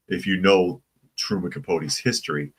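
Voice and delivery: usual speaking voice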